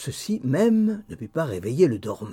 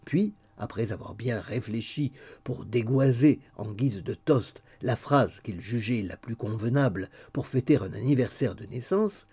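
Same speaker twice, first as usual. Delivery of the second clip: plain reading voice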